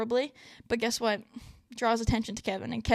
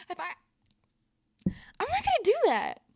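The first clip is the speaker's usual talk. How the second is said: emphatic refusal; 9 semitones higher, 3 dB louder than usual. Real, not acted.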